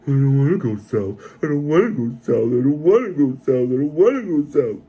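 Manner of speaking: mildly mocking Paul's reluctance; mocking Paul's reluctance with a lightly whiny repeated refrain. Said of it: "He doesn't sit there and go".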